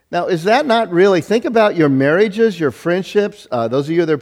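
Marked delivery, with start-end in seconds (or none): none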